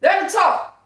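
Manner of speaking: high, loud spiritual shout; screaming in the spirit. Real, not acted. Shouting in the spirit at her haters. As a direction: cry it out with full spirit. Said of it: "That's screaming in the spirit".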